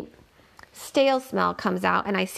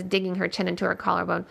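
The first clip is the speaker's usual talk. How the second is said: usual speaking voice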